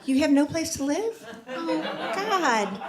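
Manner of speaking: high, slow, drawn-out condolence; exaggerated sympathy. Consoling a friend with nowhere to live, hammed-up sympathy. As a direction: pour on the pity, dragging each word.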